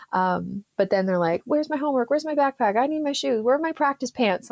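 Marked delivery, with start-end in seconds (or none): 1.5-4.5 put-on higher, rapid-fire childish demands; hammed-up kids' demands. Kids swarming mom after session, mock frantic demands. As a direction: pile on the questions like frantic kids.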